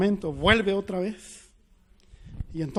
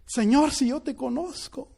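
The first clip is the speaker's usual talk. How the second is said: retold desperate cry; 6 semitones higher, slower than usual. Empieza ella a gritar.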